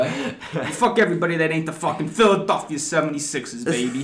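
hammed-up Philly accent, sports fan bragging. Podcaster hypes the Sixers on request, mock Philly accent. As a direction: put on your best brash Philly accent. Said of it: "Straight from the streets of Philly".

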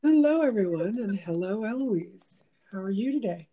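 usual speaking voice

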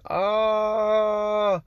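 long, high, held 'aah'; restrained mock scream. Avoiding attention from police nearby, held-back high mock scream. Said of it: "Ich will jetzt nicht laut schreien".

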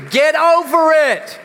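loud, slow call to the congregation; slow rallying shout. Shown as a demonstration. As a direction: belt it out for the whole room. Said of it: "Turn to your neighbor and say".

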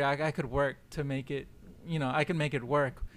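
ordinary talk, nothing marked

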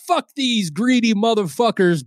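hammed-up disgruntled review; angry listener leaving one star; mock angry reviewer. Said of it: "changing your one star".

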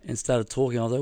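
ordinary talk

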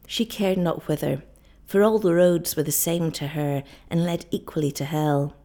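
ordinary delivery, nothing marked

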